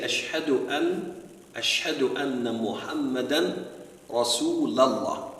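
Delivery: "Rasulallah" with a clear a after the l; slow "Rasulallah", a replacing u before Allah; fatha on Rasul. Shown as a demonstration. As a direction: say "Rasulallah" slowly, ending Rasul in -la. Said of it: "they put a fatha on Rasul".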